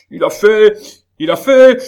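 very high, loud sputtering shout; furious outcry. Acted. Baron sputtering at his son, very high loud furious cry. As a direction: choke on the rage as you repeat it. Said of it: "s'écria le baron".

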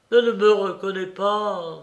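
anguished exclamation from a rejected man; despairing cry. A narrator's reading. Colonel unrecognized by the woman he calls to, despairing cry. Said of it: "s'écria le colonel au désespoir".

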